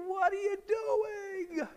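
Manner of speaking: very high, hammed-up outraged owner; comic owner voice. Homeowner yelling at the men on his roof, in a very high, hammed-up voice. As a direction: squeal like the owner seeing his new roof torn up. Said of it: "why they talk like this".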